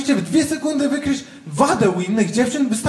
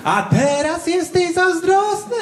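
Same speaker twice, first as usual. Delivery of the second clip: put-on teasing girlfriend; 7 semitones higher, slower than usual. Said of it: "Przeliżę czasem".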